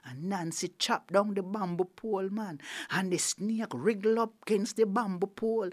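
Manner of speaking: ordinary delivery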